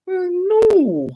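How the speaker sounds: high, loud drawn-out wail; hammed-up horrified refusal; mock dismay. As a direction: wail it in comic despair. Acts out his dismayed refusal.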